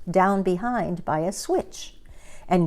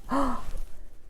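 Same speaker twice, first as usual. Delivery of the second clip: breathless gasp; 6 semitones higher, 7 dB quieter than usual. A narrator's reading. Gertrude stuck on hill.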